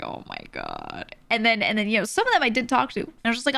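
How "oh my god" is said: much lower, slow, deadpan groan; exasperated groan. Real, not acted. Streamer reacting to fake friends, low slow groan. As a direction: drag it out low in disbelief.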